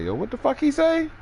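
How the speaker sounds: very high, puzzled voice; a very high, baffled voice; confused roommate. Retold or imitated. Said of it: "you just hear somebody say".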